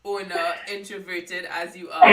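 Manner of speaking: loud, laughing playful jab; laughing tease. Real, not acted. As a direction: tease her through a big laugh. Host teases guest, both laughing.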